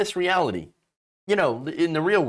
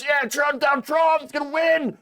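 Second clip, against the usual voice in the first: high, loud mock cheering for Trump; mock fan shout; 11 semitones higher, 3 dB louder than usual. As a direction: yell it like a hyped-up fanboy. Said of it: "It was all about".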